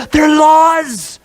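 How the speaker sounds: hammed-up warning to the king; mock warning shout. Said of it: "He says".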